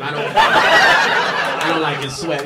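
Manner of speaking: slow, laughing mock voice; lightly voiced sneering onlooker; imitating judging man. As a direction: drag out the disapproval with a laugh.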